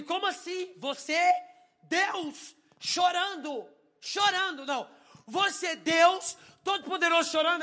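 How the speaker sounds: loud, high, emphatic disbelief; incredulous outburst. Retold or imitated. Challenging God about crying, incredulous outburst. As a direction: throw each word out as an astonished question.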